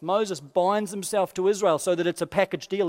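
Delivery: normal talking voice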